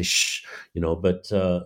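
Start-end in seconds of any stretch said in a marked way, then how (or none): 0.0-0.4 imitated "shh"; shushing. Audiences next to them hushing the talkers, shushing. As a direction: shush a talkative neighbour. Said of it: "the audiences next to them say".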